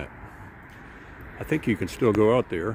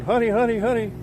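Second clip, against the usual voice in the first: high, louder, frantic calling; panicked wife; 10 semitones higher, 4 dB louder than usual. Retold or imitated. Wife calling husband while the boat slides away, higher and panicky. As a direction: call for help as the rope slips. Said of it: "my poor wife's going".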